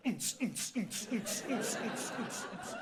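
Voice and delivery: mouthed techno thump and hi-hat; club beat imitation. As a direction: imitate relentless shop dance music. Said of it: "les caissières de H et M". (imitation de boum-boum techno)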